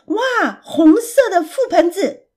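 high, thrilled exclamation; excited shout. A narrator's reading. Pig spots red raspberries by the path, excited shout. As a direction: cry out in delight at a find. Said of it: "他兴奋地喊着".

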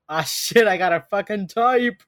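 loud, hammed-up dismay; mock dawning realization. Host voices Reed realizing he has a type, mock dismayed. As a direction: swear it out, rising in pitch at the end.

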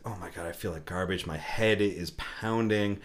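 low, dragging hungover groan; groggy hungover murmur. Retold or imitated. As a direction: moan it like your head is splitting. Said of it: "I was like".

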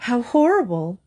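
a touch of horrified wailing; wailed. A narrator's reading. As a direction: lament in dismay. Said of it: "wailed Missus Semicolon".

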